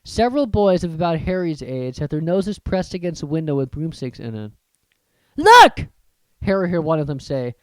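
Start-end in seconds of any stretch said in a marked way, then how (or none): 5.4-5.9 very high, loud call; excited shout. Acted. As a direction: point it out to friends. Boy calling out.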